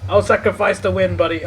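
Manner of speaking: loud. Real, not acted. Loud pledge to teammate.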